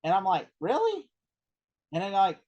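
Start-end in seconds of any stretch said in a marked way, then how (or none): 0.6-1.1 high squeaked "Really?" of disbelief; stunned disbelief. Retold or imitated. Jonah Hill to Scorsese, startled disbelief. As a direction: squeak it out in shock.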